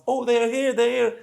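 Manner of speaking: high, loud, excited calling out. Retold or imitated. Man on Facebook Live spotting his friends, high excited calling. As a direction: call out high and loud as you spot them. Man spots them from the wall.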